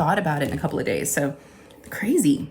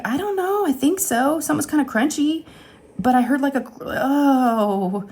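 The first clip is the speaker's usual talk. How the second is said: much higher, fretful and unsure; anxious high voice; 8 semitones higher than usual. Retold or imitated. Telling the girls whether she swallowed the tooth, anxious. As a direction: sound worried. Retelling her worried self.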